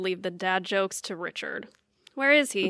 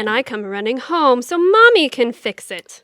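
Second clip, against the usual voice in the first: mocking retort; 8 dB louder than usual.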